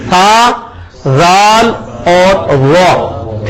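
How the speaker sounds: "سا، زال اور وا" drawn out very slowly, each letter exact; three Arabic letters. Shown as a demonstration. Teacher sums up the three letters for students, very slowly. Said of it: "تینوں حرف ایک مخرج سے".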